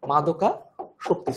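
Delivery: মাদকাসক্তি drawn out very slowly, syllable by syllable; teacher spelling out মাদকাসক্তি very slowly; slow, careful pronunciation. Shown as a demonstration. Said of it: "এই যে এইটা হচ্ছে এইটা".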